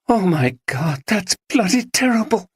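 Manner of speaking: shocked. A narrator's reading. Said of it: "could scarcely speak".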